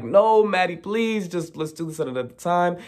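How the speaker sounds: high, quick, urgent plea; urgent pleading. Retold or imitated. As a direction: try to calm the fight. Kat pleading amid the fight.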